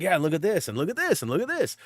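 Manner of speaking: mock excited browsing. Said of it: "we were like".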